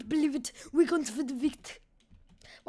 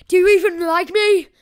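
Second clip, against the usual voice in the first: higher, much louder outburst to viewers; mock-needy shout; 4 semitones higher, 13 dB louder than usual. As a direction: demand it loudly, half-joking. Pleading loudly with the viewers.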